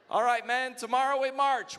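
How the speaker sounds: loud, high rallying call; rally the troops for battle; commanding officer. Retold or imitated. Voicing Civil War commander.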